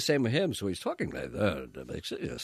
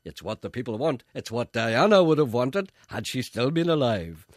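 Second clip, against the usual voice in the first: sarcastic caller; 3 semitones higher, 9 dB louder than usual. Retold or imitated. A listener mocking the stand-in on air, louder. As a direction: heap on the sarcasm.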